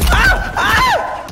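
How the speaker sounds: high panicked yells; scared screams. Real, not acted. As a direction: scream in fright.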